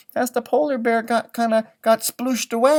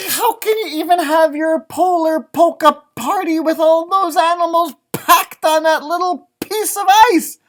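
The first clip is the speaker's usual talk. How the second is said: higher, louder, amazed question; excited disbelief; 10 semitones higher, 10 dB louder than usual. Real, not acted. Asking the listening kids, amazed and excited. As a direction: marvel at the crowded ice.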